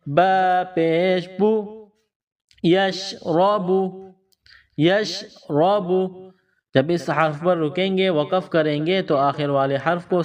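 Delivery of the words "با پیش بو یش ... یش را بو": بو and یش را بو drawn out slowly; slow spelling drill. Shown as a demonstration. Teacher spelling the word for pupils. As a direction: stretch each syllable as you spell. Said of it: "با پر پیش ہے".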